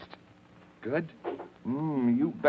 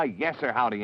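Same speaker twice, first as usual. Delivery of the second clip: ordinary talk, nothing marked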